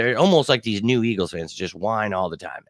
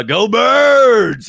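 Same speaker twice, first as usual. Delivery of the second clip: long stretched-out shout, much higher and louder; rallying cry; 11 semitones higher, 8 dB louder than usual. Real, not acted. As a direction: drag it out like a stadium chant. Fan rallying cry to chat.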